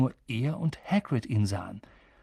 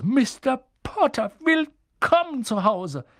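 much higher, slow and drawn out, tearful welcome; feierlich gesteigert; 10 semitones higher, 3 dB louder, slower than usual. Acted. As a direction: stretch each word out, overcome. Barmann wiederholt den Gruß. (alter Wirt, hoch, langsam und feierlich)

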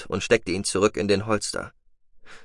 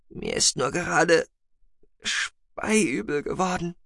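high, halting woman feeling sick; nauseous; 8 semitones higher, slower than usual. A narrator's reading. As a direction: force it out slowly, fighting nausea. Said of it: "Nur mit Mühe brachte sie den Würgereflex unter Kontrolle". (mühsam, stockend, mit Übelkeit)

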